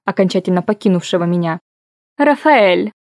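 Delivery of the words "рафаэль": sweet drawn-out purring call; сладкое мурлыканье. A narrator's reading. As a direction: drawl the name like honey. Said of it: "позвала его Тюльпана, растягивая гласные". (сладко, протяжно, медовым голосом)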